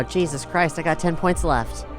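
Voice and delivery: ordinary delivery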